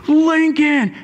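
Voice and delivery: put-on high called-out "Lincoln!" to a found child; calling out his name. Father spotting his lost son and calling his name, high call.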